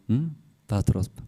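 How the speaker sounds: ordinary talk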